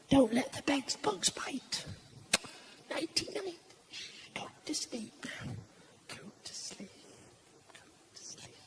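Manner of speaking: hushed goodnight fading almost to nothing; acted tiptoe-out whisper; bedtime whisper. Parent whispering child to sleep.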